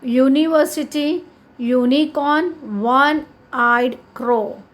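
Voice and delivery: words drawn out for the class to repeat; slow read-aloud. Shown as a demonstration. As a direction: model each word slowly. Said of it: "Now read these words aloud".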